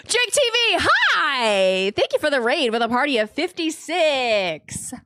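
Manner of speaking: sing-out streamer hello; excited greeting. Real, not acted. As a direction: welcome the raiders with big energy.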